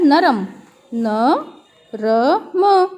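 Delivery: "नरम" then slowly "न", "र", "म" with pauses; spelled out. Shown as a demonstration. Teacher spelling "नरम" slowly for pupils. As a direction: say the word, then spell it sound by sound. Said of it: "muffler कैसा होता है?".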